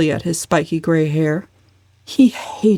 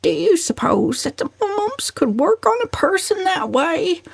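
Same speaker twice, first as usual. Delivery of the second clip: put-on wailing old cousin; 12 semitones higher than usual. A narrator's reading. Cousin Stickles wailing to the family, high and fretful. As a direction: wail it out helplessly. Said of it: "wailed Cousin Stickles".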